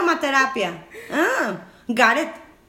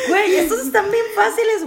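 high-pitched elated exclamation; excited laughing; 8 semitones higher, 4 dB louder than usual. Real, not acted. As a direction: say it through laughter, buzzing from the win. Elated after a match. (emocionada y entre risas)

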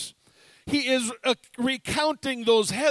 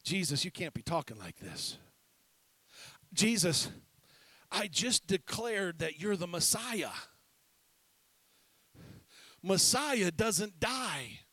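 much softer, lower, urgent aside; hushed rebuke; 5 semitones lower, 6 dB quieter than usual. Retold or imitated. Peter taking Jesus aside, a hushed rebuke. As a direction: pull him aside and rebuke quietly.

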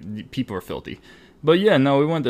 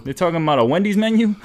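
mock confused guest; 7 semitones higher, 3 dB louder, faster than usual. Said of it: "people were like".

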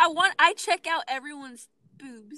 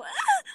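high squeaky anime yelp; sudden cute cry of surprise; anime girl yelp; 5 dB louder than usual. Retold or imitated. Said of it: "they'll go".

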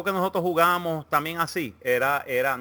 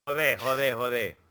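Spanish accent; 5 semitones lower, 4 dB quieter than usual. Shown as a demonstration. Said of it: "acento español, joder".

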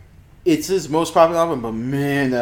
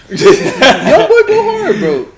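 laughing high and loud; cracking up while hyping it; 12 dB louder, much slower than usual. Real, not acted. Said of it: "You stupid".

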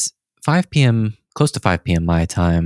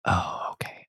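whisper; 12 dB quieter than usual. Retold or imitated. Caught off guard by the manager.

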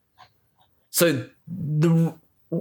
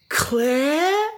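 pitiful creature call; 12 semitones higher than usual. Retold or imitated. Lisa's pitiful call, voiced high.

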